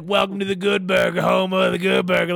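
a quick goofy Good Burger counter greeting; joking before the camera bump, mock Good Burger greeter; Good Burger bit. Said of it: "like, doing that shit".

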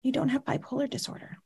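quiet firm psychiatrist. Retold or imitated. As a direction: quietly tell a patient the truth. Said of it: "She leans forward and says".

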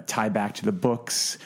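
usual speaking voice

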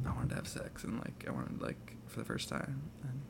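hushed embarrassed whisper. Acted. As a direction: whisper it so nobody hears. Confessing sex in hushed voice.

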